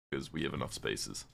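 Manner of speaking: normal talking voice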